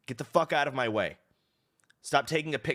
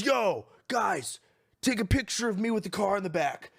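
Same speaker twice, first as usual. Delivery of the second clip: put-on higher, hyped-up young guy voice; mock dude kid; 8 semitones higher than usual. Teen bro asks his friends for a car photo, mock hyped. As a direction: imitate a bro begging for a photo.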